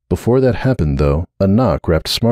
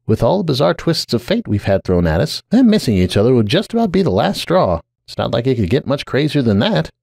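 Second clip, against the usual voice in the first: wry; 5 semitones higher than usual. Acted. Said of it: "Sunburst said wryly".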